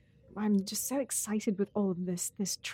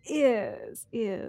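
posh British ears. Shown as a demonstration. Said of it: "it sounds like yes, but posh".

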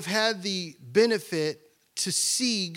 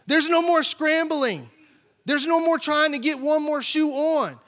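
preaching; 8 semitones higher, 4 dB louder than usual. Acted. Preaching builds.

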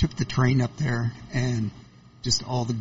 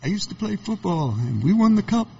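put-on higher, chuffed old football fan voice; proud old man; 7 semitones higher than usual. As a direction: boast like an old man reliving his glory days. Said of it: "it was like".

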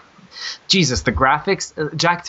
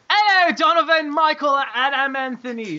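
hammed-up greeting; 12 semitones higher than usual. Host reading old friend's email greeting to co-hosts, hammed-up. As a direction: call out the names in a high, theatrical voice.

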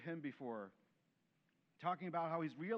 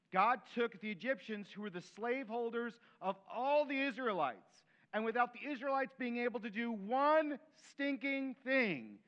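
building, louder preacher's delivery; preaching; 3 semitones higher, 5 dB louder than usual. Acted. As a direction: preach it with rising force. Preaching at full force.

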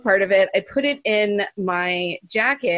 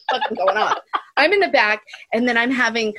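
normal talking voice

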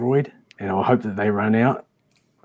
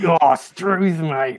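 Australian; 7 semitones higher, 3 dB louder, much slower than usual. Pretend. Keeps the Australian accent bit.

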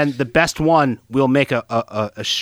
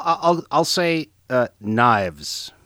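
normal talking voice

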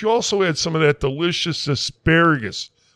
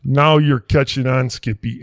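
ordinary delivery

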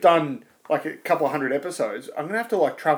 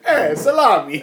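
laughing, higher mock Italian; mock Italian accent; 4 semitones higher, 6 dB louder than usual. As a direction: tease with a hammed-up Italian accent. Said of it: "That was Italian".

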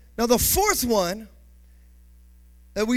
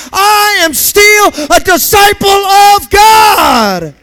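loud, high preaching shout; full-throated climactic sermon shout; preaching at full force; 8 semitones higher, 16 dB louder than usual. Acted. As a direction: drive it home to the congregation.

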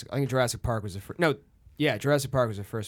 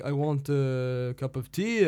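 slow French accent rising on tea; 3 semitones higher, slower than usual. Shown as a demonstration. Actor demonstrating French speech rhythm, slow and rising. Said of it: "And the French".

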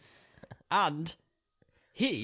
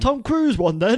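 high, loud dopey imitation; teasing impression of the friend; mock stoner friend; 11 semitones higher, 8 dB louder than usual. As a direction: ape the friend's dim voice. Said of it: "Does he sound like that".

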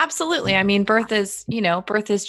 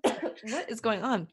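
coughing fit; 7 dB quieter, slower than usual. Real, not acted. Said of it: "I'm coughing so much".

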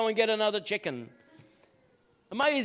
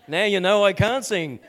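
laughing at himself; 6 dB louder than usual. Real, not acted. Laughing at his own singing.

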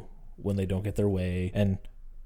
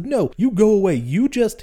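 higher and louder, fed up; annoyed big brother; 10 semitones higher, 6 dB louder than usual. Retold or imitated. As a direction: shove her off with your voice. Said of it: "And he's like".

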